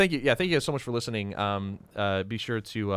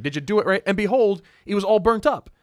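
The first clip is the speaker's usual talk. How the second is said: high, louder alarmed question; worried Moses voice; 11 semitones higher, 7 dB louder than usual. Retold or imitated. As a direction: fret like an anxious Moses. Voicing Moses finding burnt offering.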